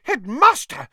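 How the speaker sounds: high, loud spluttering protest; spluttering outrage. Acted. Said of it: "spluttered Madame Pumphrey".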